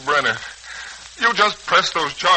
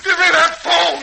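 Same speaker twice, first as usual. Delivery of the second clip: shouted, much higher demand; desperate shout; 12 semitones higher than usual. Acted. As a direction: yell it as you grab for the phone. Son fights for the phone.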